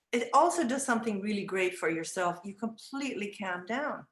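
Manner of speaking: normal talking voice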